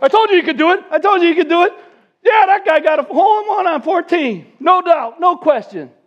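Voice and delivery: high, loud crowing; excited onlookers. Retold or imitated. Onlookers to each other after the huge drive, excited crowing. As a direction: imitate a crowd of men vindicated and thrilled. Crowd of men cheering the drive.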